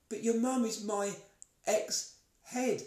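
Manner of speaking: high, astonished disbelief; can't believe it; incredulous. Retold or imitated. Retold disbelief, much higher.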